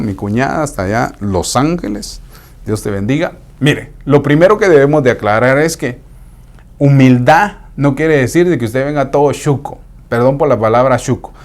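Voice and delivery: normal talking voice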